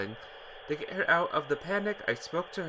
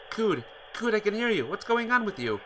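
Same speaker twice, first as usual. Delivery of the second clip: urgent calling; 8 semitones higher, 4 dB louder than usual. Acted. Ricky calling to Cood.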